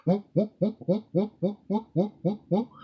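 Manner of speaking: playful monkey hoots; monkey whoops. Acted. Monkeys arrive calling.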